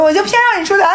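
high-pitched, playful insisting; mock insistence. Customer pushing the club hostess to go out, mock insistence. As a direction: retell a stubborn demand, pitch shooting up. Retelling a bickering standoff.

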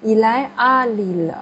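il est à Lille, slow, with the t of est carried onto à; slow model reading that links est and à; linked carefully. Shown as a demonstration. Teacher modelling the correct liaison, slow and linked. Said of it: "应该说".

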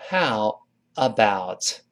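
slow, drawn-out "How about"; "How about" stretched out for learners; separated words. Shown as a demonstration. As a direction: say "How", then "about", each word slow and full. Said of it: "这么一个".

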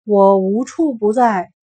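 slow, loud wizard proclamation; slow booming voice. A narrator's reading. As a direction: intone it like an unseen god. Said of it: "那声音说".

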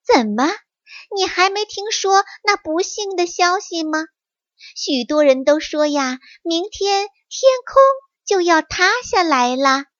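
high, put-on astonishment; mock surprised fox; feigned surprise. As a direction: fox faking shock to fool the tiger. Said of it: "狐狸装着吃惊的样子说".

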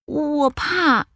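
high small cub voice; timid little bear. Acted. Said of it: "小小熊说".

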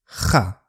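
哈 with a rasped throat h like Spanish jota; 喉音哈; rough Spanish j. Shown as a demonstration. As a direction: scrape the 哈 from the back of the throat. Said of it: "我们的哈更像哈".

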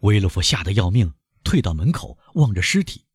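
usual speaking voice

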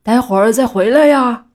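loud farewell holler; loud, put-on group shout. A narrator's reading. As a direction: call after someone who is leaving. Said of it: "大喊".

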